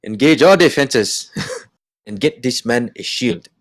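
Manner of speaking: slow, laughing attempt at a Wakandan accent; a slightly slow, giggly try at a Wakandan accent. Podcast cohost asks him to do it: laughing Wakandan accent.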